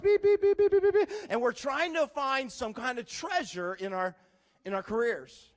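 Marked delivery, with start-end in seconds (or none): 0.0-1.1 loud, high run of rapid beeps; frantic detector beeps. Retold or imitated. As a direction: mimic a detector going off hard. Detector going off over being boss.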